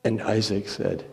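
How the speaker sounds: usual speaking voice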